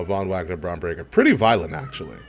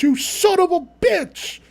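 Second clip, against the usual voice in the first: high, far louder shocked commentator scream; Vic Joseph shocked; 4 dB louder than usual. Retold or imitated. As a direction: shriek it like a stunned announcer. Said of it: "Vic Joseph in his most shocked voice".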